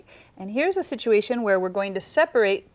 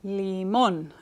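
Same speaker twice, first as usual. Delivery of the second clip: "Limón" with the weight on "món"; stress at the end; 3 semitones lower than usual. Shown as a demonstration. Student listening for the emphasis, stress at the end. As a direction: lean on the last syllable of "Limón". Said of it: "escucho énfasis al final".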